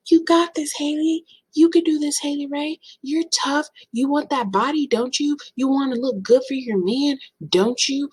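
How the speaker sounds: high-pitched cheerleader-style self-encouragement; mock pep talk. Psyching herself up before surgery, high mock coaxing.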